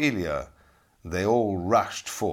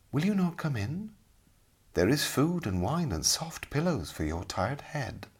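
soft, lighter welcoming lady; gentle lady voice; 6 semitones higher, 4 dB quieter than usual. Acted. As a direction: lure him in softly. The lady entices Ilya.

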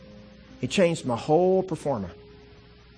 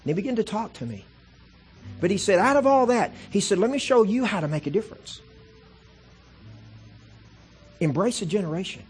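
full-force preaching; 5 semitones higher than usual. Acted. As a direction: preach it out to the whole room. Preaches God's words at full force.

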